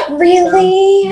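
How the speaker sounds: gasp, then a high delighted "really"; real high-pitched surprise; excited surprise. Real, not acted. Hears grandbaby is due any day.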